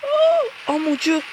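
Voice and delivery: high cracking shocked cry. Real, not acted. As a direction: voice breaks in shock. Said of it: "je suis choqué".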